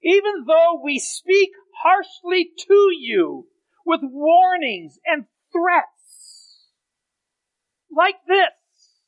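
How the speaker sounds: drawn-out words, heavy stress; slow emphatic reading. Acted. Preacher reads warning to the church, slow and heavy. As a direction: lean hard on each word.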